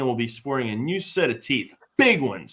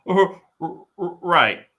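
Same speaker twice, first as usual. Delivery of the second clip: stuttering, higher and louder; frightened stammer; 5 semitones higher, 6 dB louder, slower than usual. Acted. Joxer, just missed by the knife, stammers high in fright. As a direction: stammer it out, still rattled. Joxer shaken after near stabbing.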